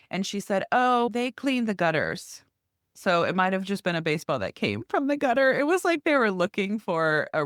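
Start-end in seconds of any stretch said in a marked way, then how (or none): 0.7-2.4 high, slow mock-innocent neighbor; changed tune. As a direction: imitate her backing down, drawn out and high. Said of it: "totally changed her tune".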